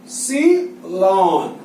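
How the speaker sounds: "एप्सिलॉन" drawn out very slowly, falling; slow pronunciation. Shown as a demonstration. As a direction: say each sound of एप्सिलॉन clearly. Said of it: "क्या पढ़ते हैं इसको". (एप्सिलॉन बहुत धीमे, साफ़ उच्चारण)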